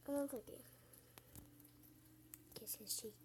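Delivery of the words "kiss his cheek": whispering. Real, not acted. Whispered line during gameplay.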